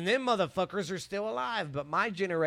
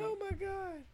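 shocked; 6 dB quieter than usual. Real, not acted. Shocked reaction.

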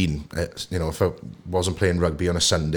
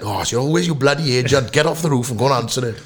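exasperated father; 5 semitones higher than usual. Retold or imitated. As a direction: imitate dad giving in crossly.

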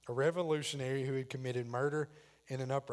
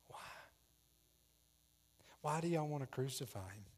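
small, soft timid question; 7 dB quieter than usual. Acted. Pilate timidly questioning the crowd, timid and soft. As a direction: ask it meekly, barely daring. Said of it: "timidly goes".

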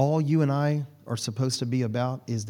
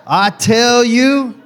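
put-on slow, high and loud island-accented "I tell you"; 9 semitones higher, 11 dB louder, much slower than usual. Pastor mimicking Miss Angela's island accent, loud and slow. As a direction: draw it out big in her island accent. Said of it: "in that, uh, that island accent that you have".

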